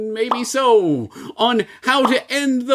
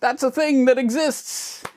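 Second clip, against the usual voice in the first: higher, hyped fanboy YouTuber voice; gushing tech fanboy; Johnny YouTuber voice; 3 semitones higher than usual. Acted. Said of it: "No, I am not Johnny YouTuber".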